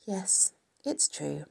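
soft, slow sighing admission; sighed. A narrator's reading. Charlotte confirms her egg sac, sighing softly. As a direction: let it out slowly on a sigh. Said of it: "sighed Charlotte".

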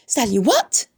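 startled cry. A narrator's reading. Pig baffled by the strange word, crying out. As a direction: cry out in confusion. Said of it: "he cried".

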